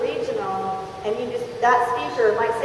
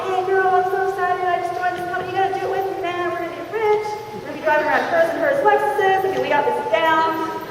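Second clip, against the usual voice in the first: high-pitched gushing to a best friend; hammed-up thrilled new recruit; giddy excitement; 7 semitones higher than usual. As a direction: gush down the phone in a high, excited voice. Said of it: "They're all excited".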